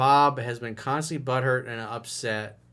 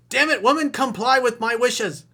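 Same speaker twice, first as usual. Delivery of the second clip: high, loud, hammed-up demand; mock angry command; 11 semitones higher, 7 dB louder than usual. Coach parodies emailer ordering woman to obey, mock exasperated command. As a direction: order her about in a mock outburst.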